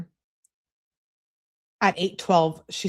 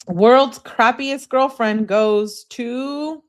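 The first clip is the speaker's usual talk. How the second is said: slow, drawn-out, higher and louder award call; mock award announcer; 8 semitones higher, 8 dB louder, much slower than usual. Host naming girlfriend for the worst award, slow mock announcer.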